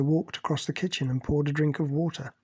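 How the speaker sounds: ordinary delivery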